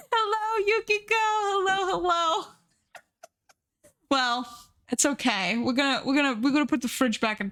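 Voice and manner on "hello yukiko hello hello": high cooing hello. Real, not acted. Cooing greeting to hamster.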